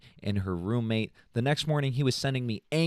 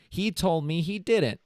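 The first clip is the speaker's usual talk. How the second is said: normal talking voice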